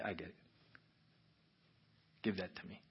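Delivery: soft, low, near-whisper; gentle hushed reply. Acted. Father answering the confessed hurt with grace, gentle hush. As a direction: offer it quietly, as comfort.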